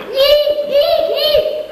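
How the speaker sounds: high-pitched fake squeals of alarm; mock panicked shrieking. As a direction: squeal high, feigning fright at a fire. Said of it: "So I'll go eek".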